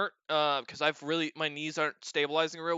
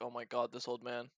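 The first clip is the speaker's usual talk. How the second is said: muttered exasperation; 5 semitones lower, 11 dB quieter than usual. Retold or imitated. Retold weary aside about old man.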